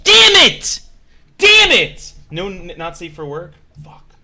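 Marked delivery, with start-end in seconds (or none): 0.0-2.1 high, very loud outburst of mock frustration; mock frustrated shout. Streamer thwarted by the seller's rules, mock frustrated shout. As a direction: yell it as if badly thwarted. Thwarted by no hate speech rule.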